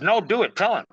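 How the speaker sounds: high, loud insistent command; spirit insisting. Retold or imitated. The spirit Orobus pressing him to tell the client, high loud insistence. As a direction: push him hard to just do it. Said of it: "And he says".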